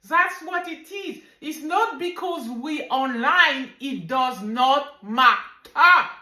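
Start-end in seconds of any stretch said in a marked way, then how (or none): none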